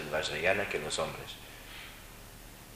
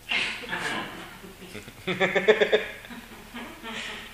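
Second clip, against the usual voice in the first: a high, amused burst of laughter; real laughter; laughing; 14 semitones higher, 4 dB louder than usual. Real, not acted. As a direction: laugh again at the hidden name. Laughs at the name joke.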